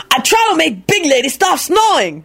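high-pitched sullen foreign character. A narrator's reading. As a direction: announce it sulkily in a put-on voice. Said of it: "announced sullenly".

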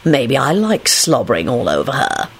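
low, sly smirking voice; nasty smirk. A narrator's reading. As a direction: tease with a smirk. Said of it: "with a nasty smirk".

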